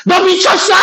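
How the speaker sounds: high-pitched acted accusation; angry outburst. Shown as a demonstration. Teacher acting out what the English phrase means, outraged cry. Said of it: "이 소리야".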